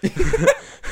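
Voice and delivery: loud high laugh; a sudden squealing laugh; high burst of laughter. Real, not acted. Laughs at mantis fact.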